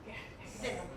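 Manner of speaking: usual speaking voice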